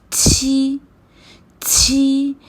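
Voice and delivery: छी with a puff of air through closed teeth; pinyin q sound. Shown as a demonstration. Teacher modelling pinyin q, airy छी. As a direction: push air out on छी. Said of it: "क्यू पढ़ते हैं".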